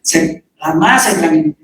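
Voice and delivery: normal talking voice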